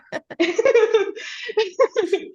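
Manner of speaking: sudden high laugh; burst of laughter. Real, not acted. Laughing at her partner's "yep", a high burst of laughter. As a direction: break into a high giggle.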